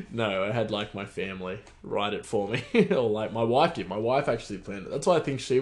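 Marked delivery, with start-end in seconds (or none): none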